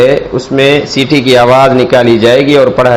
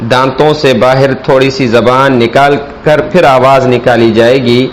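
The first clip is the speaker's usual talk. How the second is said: usual speaking voice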